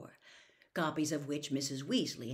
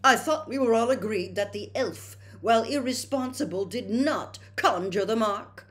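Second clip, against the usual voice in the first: heated and indignant, louder and higher, slowing at the end; a young official snapping at his family; hotly; 7 semitones higher, 9 dB louder than usual. A narrator's reading. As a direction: defend your boss with prickly indignation. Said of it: "said Percy hotly".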